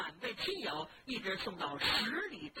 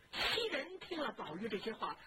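plain reading voice

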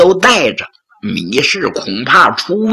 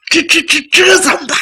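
flustered panic; 12 semitones higher, faster than usual. Acted. Wife panics at the threat.

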